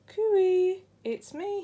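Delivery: high, loud joyful call. A narrator's reading. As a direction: call out to the shoal at last. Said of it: "he called".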